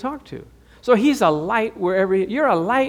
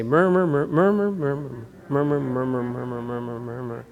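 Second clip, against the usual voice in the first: grumbling murmur that fades away softer; fading murmur; 5 semitones lower, 5 dB quieter than usual. Shown as a demonstration. Pastor leading the congregation in a fading grumbling murmur. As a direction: mumble the murmurs down to nothing. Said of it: "Just say".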